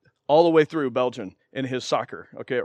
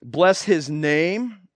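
slow emphasis; much slower than usual. Shown as a demonstration. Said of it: "he says".

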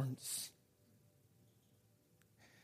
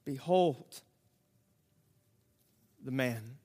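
softer, hushed repeat; quiet echo; 6 semitones lower, 6 dB quieter, slower than usual. Acted. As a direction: let it sink in softly.

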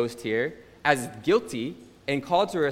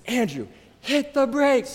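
high, slow, shrieking Andrew, hit the brakes; panicked mom screaming at her son; retold scream; 10 semitones higher, 3 dB louder than usual. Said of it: "She screams at me".

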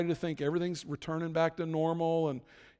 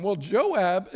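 ordinary talk

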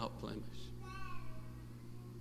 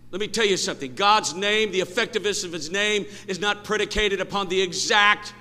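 raised, higher pulpit voice; preaching; 8 semitones higher, 9 dB louder than usual. Real, not acted. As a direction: press the point on the congregation, louder. Preaching builds up.

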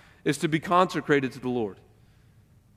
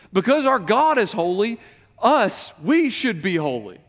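preaching emphatically; 5 semitones higher, 5 dB louder than usual. Real, not acted. Sermon climax.

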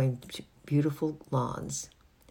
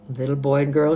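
put-on louder, lightly posh old lady; 9 dB louder than usual. A narrator's reading. Old lady lectures the children on her doorstep, posh. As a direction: address them loudly in a posh voice. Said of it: "in a very posh voice".

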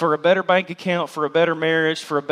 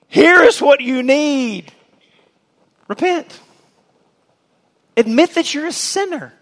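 prophet's demand; 9 semitones higher, 6 dB louder than usual. Acted. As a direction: command them like a prophet. John preaching repentance.

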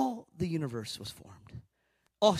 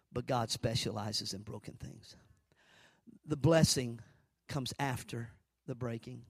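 soft intimate preaching; 5 semitones lower, 3 dB quieter than usual. Acted. Preacher speaking softly to the congregation about brokenness, hushed. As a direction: draw them in close and hush it.

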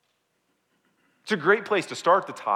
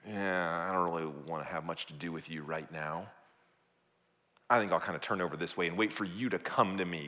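low, softer, reluctant brush-off; cool withdrawal; 9 semitones lower, 6 dB quieter than usual. Acted. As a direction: pull away from the listener, half-interested. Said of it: "God's heart recoiling within him, pulling back".

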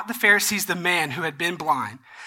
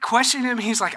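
normal talking voice

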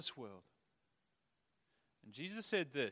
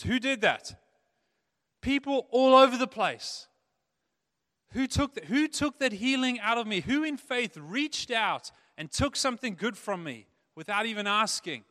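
loud demanding call to the crowd; louder, searching the crowd; 8 semitones higher, 14 dB louder than usual. Acted. Jesus calling out to the crowd, loud.

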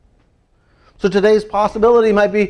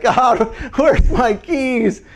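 higher, slow, drawn-out whine; mock pleading; 7 semitones higher, much slower than usual. Preacher mimicking someone begging God for lost keys. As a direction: act out a desperate plea. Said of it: "And you're like".

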